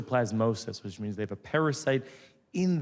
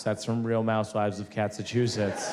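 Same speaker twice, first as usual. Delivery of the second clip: normal talking voice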